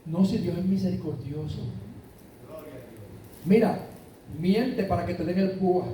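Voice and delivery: low, coaxing voice of temptation; voice of sin tempting. Acted. Preacher voicing sin as it lures the believer, low and coaxing. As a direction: speak as sin whispering excuses in your ear. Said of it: "engaña y siempre te dice".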